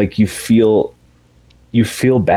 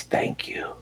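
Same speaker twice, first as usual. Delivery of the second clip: barely-there imitated whisper; dying whisper; 13 dB quieter than usual. Norman thanks his brother as he dies, whispered. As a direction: whisper it like last words. Dying man's last words, retold.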